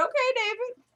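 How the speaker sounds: high, rising note of surprise; impressed surprise. Retold or imitated. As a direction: react as a surprised, impressed onlooker. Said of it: "I was surprised".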